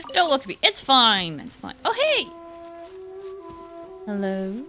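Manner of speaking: ordinary delivery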